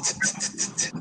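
short unvoiced tss hisses, soft; hissed tss. Shown as a demonstration. Teacher shows the sound that replaces the ED ending, hissed tss. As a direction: hiss the ending like a quiet tss. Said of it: "decimos tss".

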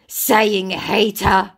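hammed-up angry comeback; loud scammer retort. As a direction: drag it out loudly as the scammer. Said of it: "your eardrums going".

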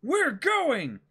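captain making the call to his crew; determined young pirate captain. Acted. Reads Luffy's lines.

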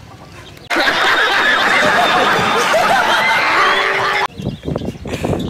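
high breathy laugh, loud; real helpless laughter; burst of laughter. Real, not acted. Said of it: "मेरे को तो इतनी हंसी आ रही है".

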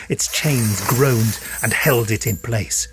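ordinary delivery, nothing marked

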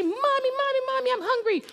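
put-on high, whiny child voice calling mommy; be the starving kids rushing in; high pleading children; hungry kids whining.

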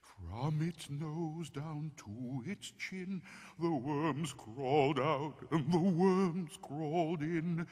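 creepy campfire poem with drawn-out oohs; spooky poem recital. A narrator's reading. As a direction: recite a ghost rhyme to give chills.

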